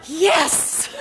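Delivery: laughing mock triumph; loud laughing yes. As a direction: shout it with a laugh. Mocked eager yes, laughing.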